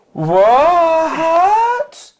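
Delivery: high, loud, drawn-out cry of disbelief; hammed-up shriek of collective astonishment; mock shock. Whole film crew reacting to the actress, in hammed-up shock. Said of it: "we all went".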